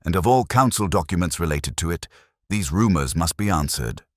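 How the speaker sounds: plain reading voice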